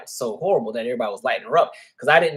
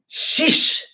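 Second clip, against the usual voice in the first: sheesh; 13 semitones higher, 6 dB louder than usual. Real, not acted. Reacting to the tweet feud.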